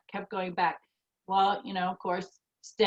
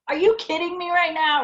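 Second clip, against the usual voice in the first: much higher, louder "are you kidding me"; exasperated outburst; 10 semitones higher, 4 dB louder than usual. Retold or imitated. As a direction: blurt it out in retold disbelief and anger. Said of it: "I was pissed".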